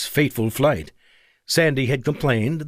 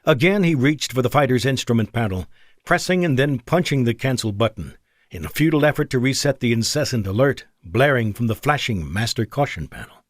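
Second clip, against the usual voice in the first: ordinary delivery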